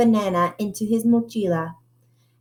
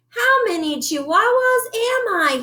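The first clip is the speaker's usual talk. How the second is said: wondering aloud; 12 semitones higher than usual. Acted. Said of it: "he wondered out loud".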